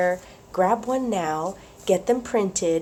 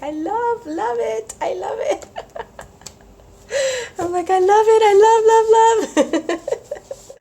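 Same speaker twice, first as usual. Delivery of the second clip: much higher, giggly gushing impression; imitating Marta; 14 semitones higher, 6 dB louder than usual. Vlogger imitating friend Marta's gushing, high giggly voice. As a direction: gush and giggle the way Marta does. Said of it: "I sound like Marta now".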